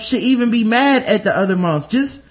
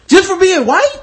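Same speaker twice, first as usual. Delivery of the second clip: high, louder disbelief; incredulous; 11 semitones higher, 5 dB louder than usual. Retold or imitated. Incredulous retold reaction.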